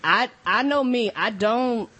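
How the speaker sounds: normal talking voice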